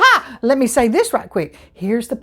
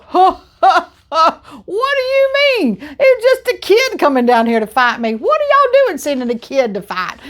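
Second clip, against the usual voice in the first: high scornful laugh, then jeering; mocking giant laugh; 6 semitones higher than usual. Goliath laughing at the boy sent to fight him, scornful. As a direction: laugh down at the little kid.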